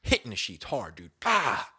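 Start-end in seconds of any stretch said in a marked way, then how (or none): 1.2-1.7 mock frustrated yell.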